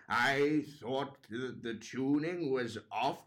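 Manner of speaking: put-on very slow old-woman criticism; difficult old judge. Old judge faulting the dads' tuning, slow and deliberate. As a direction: draw each word out as a ninety-two-year-old critic. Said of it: "it reaches Margaret".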